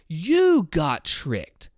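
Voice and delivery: "you" lifted and stretched very slowly; stress on you. Shown as a demonstration. Creator explaining emphasis, very slow with "you" stressed. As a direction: lean hard on "you" in "You got tricked". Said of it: "you are the main subject and the emphasis".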